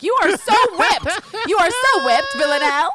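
high, loud, laughing taunt; loud teasing. Pretend. Host teases Villanelle over her crush, loud gleeful taunt. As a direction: crow it way up high, stretching the words.

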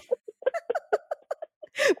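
real high-pitched laugh. Real, not acted. Laughing at dad's joke.

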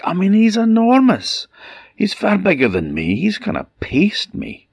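high, alarmed storybook voice; fretting aloud, voice leaping high; panicked giant. Acted. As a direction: pitch shoots up in mock dread. Frightened giant confessing to his wife.

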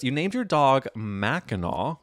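ordinary talk

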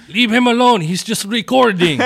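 high, loud, laughing dad call. Retold or imitated. Dad voiced, calling from background.

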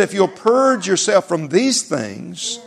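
very slow and deliberate, "purge" lifted; slow scripture emphasis. Real, not acted. Preacher slowly quoting Timothy to born-again Christians. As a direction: lay each word down like a verdict.

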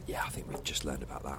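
hushed, quick whisper; whispered aside. Real, not acted. Cohost whispering a quick aside to the others. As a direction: mutter it under the breath.

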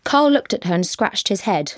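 usual speaking voice

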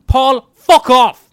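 slow furious yell; shouting. Acted. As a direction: bellow it at him. Yelling at staring neighbour.

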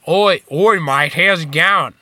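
loud, drawn-out mock Australian accent; mimicked Australian accent. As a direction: exaggerate the Aussie vowels, slow and loud. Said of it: "while he mimicked our accent".

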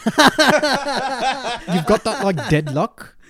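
high laugh breaking through; laughing while quoting. Retold or imitated.

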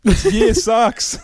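loud, high, slow and laughing; exasperated words breaking into laughter; laughing complaint. Real, not acted. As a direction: crack up while complaining. Breaks into laughter over dull results.

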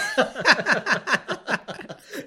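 high bright burst of laughter; rising loud laugh. Real, not acted. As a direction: crack up at the innuendo. Laugh at reading joke.

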